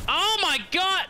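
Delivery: sudden high loud cry; startled shout. Real, not acted. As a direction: jump at the scare. Jump scare.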